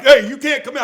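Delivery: squeaky hammed-up objection; protesting clerk. Fat gunny protesting, retold.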